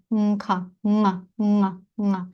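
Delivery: nga syllables started with a humming back-of-tongue closure; repeated velar nasal drill; throat closure nasal. Shown as a demonstration. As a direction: isolate ng, then release into a vowel. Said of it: "утворюється як така смичка".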